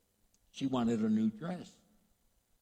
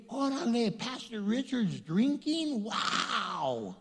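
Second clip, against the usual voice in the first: surprised onlooker; 7 semitones higher, slower than usual. Retold or imitated.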